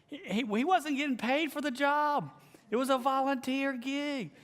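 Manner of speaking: high-pitched, indignant disbelief; worked-up, voice shooting way up; incredulous exclamation. Real, not acted. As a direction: protest at how absurd it was. Incredulous at the man's overreaction.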